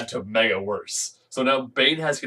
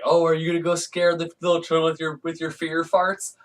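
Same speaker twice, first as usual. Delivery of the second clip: mocking pig guy; 5 semitones higher than usual. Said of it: "this pig guy calls".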